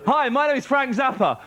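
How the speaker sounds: ordinary delivery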